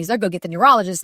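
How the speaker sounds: usual speaking voice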